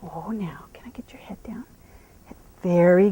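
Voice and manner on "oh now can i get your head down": soft unvoiced whisper to calm him; soothing whisper. Real, not acted. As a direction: hush him down gently. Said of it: "I'm just loving him here".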